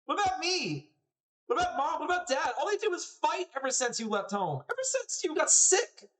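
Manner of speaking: put-on very high, upset boy's outburst; distraught little brother. Little brother blames sister for leaving family behind, distraught. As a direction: cry out in accusation, pitch way up.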